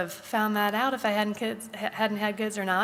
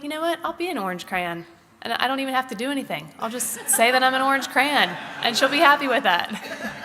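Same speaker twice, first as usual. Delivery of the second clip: playful resolve; 5 semitones higher, 5 dB louder than usual. Retold or imitated. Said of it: "But then I was just like".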